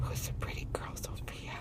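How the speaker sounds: soft whisper to a pet. Real, not acted. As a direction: whisper it gently. Whispering to the parrot.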